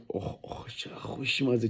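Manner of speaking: hushed whisper savoring the taste. Real, not acted. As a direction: breathe it out over the food.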